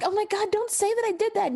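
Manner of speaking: high-pitched acted mortification; mortified. [name] hearing her kinks discussed, mock mortified. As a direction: protest in a high, embarrassed voice.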